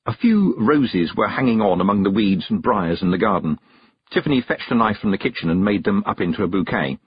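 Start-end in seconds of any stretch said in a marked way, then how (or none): none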